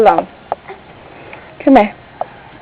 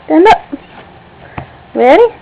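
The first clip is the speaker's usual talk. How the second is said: ordinary delivery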